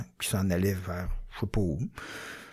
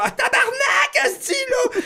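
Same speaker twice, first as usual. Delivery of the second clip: high, slow, laughing panicked yell; panicked shout; 4 dB louder than usual. Retold or imitated. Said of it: "Je suis comme".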